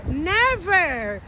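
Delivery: usual speaking voice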